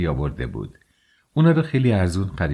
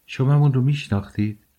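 higher-pitched, slightly surprised question; mildly surprised; 6 semitones higher than usual. A narrator's reading. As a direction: ask with a touch of surprise. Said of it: "سونیا با کمی تعجب پرسید". (با کمی تعجب)

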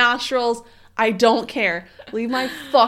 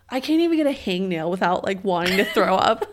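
ordinary talk, nothing marked

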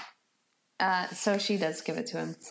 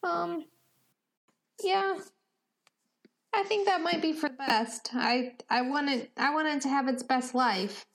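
put-on lightly raised, earnest young Benny; a gentle, hopeful character voice, a bit higher; Benny's voice; 7 semitones higher than usual.

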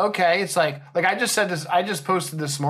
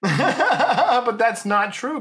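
slow words through a laugh; 8 semitones higher, 4 dB louder, much slower than usual. Real, not acted. Laughs after ad.

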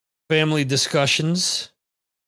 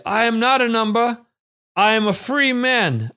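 raised, higher, proclaiming like a rebel hero; dramatic defiant declaration; 8 semitones higher, 4 dB louder than usual. Retold or imitated. Host voicing a chat viewer, mock-defiant British declaration. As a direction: declare your freedom with mock grandeur. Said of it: "Brad will say".

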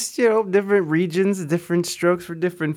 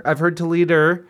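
ordinary talk, nothing marked

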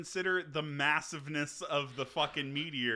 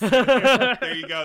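slow, much louder and higher cheer; drawn-out cheer; 8 semitones higher, 16 dB louder, much slower than usual. Real, not acted. Friend cheers the serial killer's death, slow loud cheer. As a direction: stretch it out like a triumphant shout.

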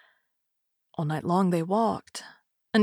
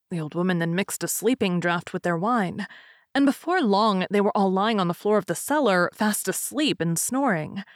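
ordinary delivery, nothing marked

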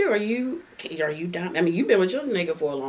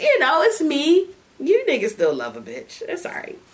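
ordinary talk, nothing marked

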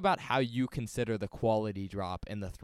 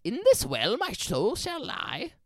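leprechaun voice; 9 semitones higher, 4 dB louder than usual. Acted. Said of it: "He's like".

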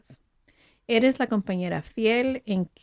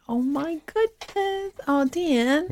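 delighted; 6 semitones higher, slower than usual. Real, not acted. Reading the spa gift.

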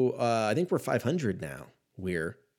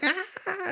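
very high mock yelp; short high comic cry; mock yelp of pain. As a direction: squeal like you got hit. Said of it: "I'm like".

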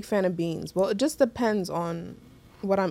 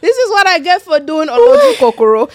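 loud laughing lament; 9 semitones higher, 13 dB louder than usual. Real, not acted. Blaming herself for taking the plate, in a loud laughing wail.

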